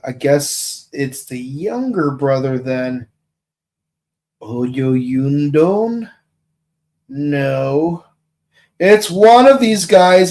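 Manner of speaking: ordinary delivery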